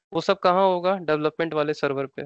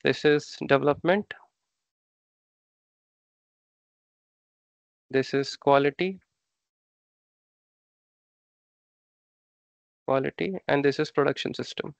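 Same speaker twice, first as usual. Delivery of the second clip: slow dictation; much slower than usual. Shown as a demonstration. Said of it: "teacher labels boxes while writing".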